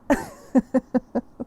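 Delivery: put-on a burst of boyish laughter; hearty laughing fit. Family laughing at the joke.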